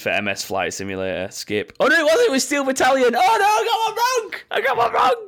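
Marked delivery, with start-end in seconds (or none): 1.8-5.3 very high, squeaky mock panic; mock dismay. Quizmaster wailing over getting one wrong, high mock dismay.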